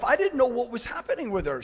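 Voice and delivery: normal talking voice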